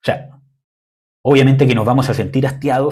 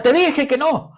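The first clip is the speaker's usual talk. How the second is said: parent yelling; 14 semitones higher, slower than usual. Retold or imitated. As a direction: shout it at the child, fed up. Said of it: "ya salió el grito".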